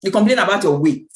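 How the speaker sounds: ordinary delivery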